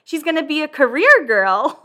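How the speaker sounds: high, loud and laughing; imitating a friend teasing through laughter; laughing quote. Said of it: "She goes".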